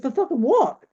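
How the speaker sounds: high, loud mock-outraged snap; indignant outburst. Retold shout at the tarot reader demanding what the ten bucks was for. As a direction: fire it back at her, half-laughing in disbelief.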